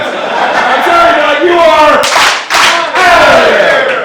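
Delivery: high, slow shouted ejection; big drawn-out shout, stretching OUT; umpire-style call-out. Acted. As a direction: bellow the dismissal like an announcer. Host calls out the failed accent.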